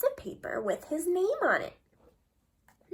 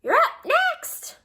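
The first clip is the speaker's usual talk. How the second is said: much higher and louder shout across the playground, slow; calling out; 14 semitones higher, 7 dB louder, slower than usual. Acted. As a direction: yell to a friend far away. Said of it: "Emilio calls out from the tetherball court".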